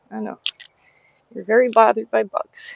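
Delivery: normal talking voice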